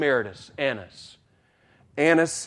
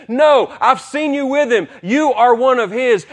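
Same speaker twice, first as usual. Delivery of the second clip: higher, louder, sure of herself; accusing servant girl; 9 semitones higher, 8 dB louder than usual. Acted. Servant girl confronting Peter in the courtyard, higher, louder accusing voice. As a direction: call him out, I saw you. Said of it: "a servant girl says".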